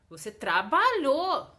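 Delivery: loud, forceful punch on "trabalhou"; emphatic intonation. Shown as a demonstration. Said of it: "faz essa entonação na voz".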